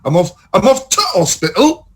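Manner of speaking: exaggerated Yorkshire, TO clipped to a T; mock Yorkshire. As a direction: send up Yorkshire with a stopped T. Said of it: "I don't say".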